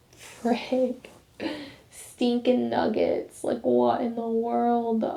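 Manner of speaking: slow, soft self-reproach, retold; dismayed muttering. Student sent to the hallway, imitated dismayed muttering to herself. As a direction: mutter it slowly under your breath.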